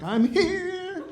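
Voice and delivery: high, put-on little voice of a breaststroker; high mock "I'm here" from the pool; squeaky swimmer voice. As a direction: chirp it like a proud swimmer.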